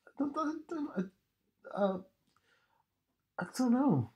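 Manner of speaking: tiny, very high hesitant voice; reluctant squeak. Real, not acted. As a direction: shrink into a small, soft squeak of doubt. Hesitating about being made up.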